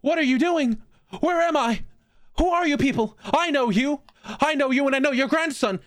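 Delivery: put-on high, frantic old woman accusing everyone; shrill panic of a lost old patient; confused old lady voice. Old patient confusing hospital staff, high frantic old lady voice. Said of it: "you gave her a Stamper voice".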